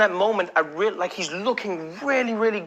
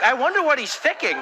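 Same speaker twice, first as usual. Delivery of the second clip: puzzled audience member; 8 semitones higher than usual. Acted. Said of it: "people in the audience who are like".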